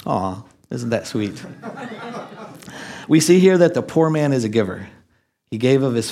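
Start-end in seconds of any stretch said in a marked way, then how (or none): 0.1-3.1 soft, slow chuckling aww; laughing aww. Real, not acted. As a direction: coo over it with a laugh. Sentimental aside on the lamb story.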